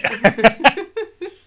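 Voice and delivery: burst of laughter. Real, not acted. Laughs at own mouthful.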